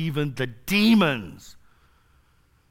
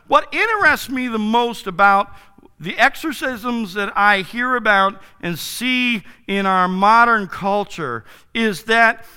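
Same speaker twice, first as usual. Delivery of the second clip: ordinary talk, nothing marked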